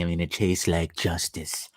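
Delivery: hushed whisper. Acted. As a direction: whisper it like a secret. Savoring coffee, repeats the line.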